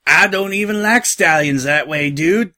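jokey put-on country accent; gravelly imitation Applejack accent. A narrator's reading. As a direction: mimic a friend's drawling accent teasingly. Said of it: "her voice a gravelly imitation of Applejack's accent".

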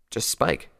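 usual speaking voice

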